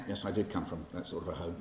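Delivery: ordinary talk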